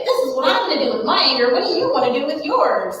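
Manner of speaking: pep it up like a game leader; playful energetic demo. Shown as a demonstration. Parent with a pillow inviting child to vent, lively. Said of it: "and say".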